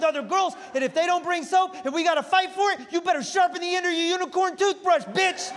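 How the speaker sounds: high, pumped-up prison hustler; rally the cellblock like a jailhouse boss; tough inmate voice. Pretend. Comic to the crowd, as a pumped-up inmate.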